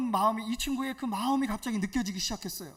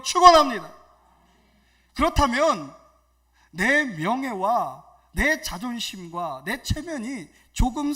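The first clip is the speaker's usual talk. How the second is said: loud, raised sermon proclamation; forceful preaching; 4 semitones higher, 10 dB louder than usual. Real, not acted. Pastor blessing and exhorting church officers, forceful preaching.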